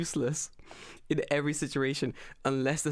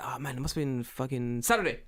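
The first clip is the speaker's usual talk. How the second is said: ordinary talk, nothing marked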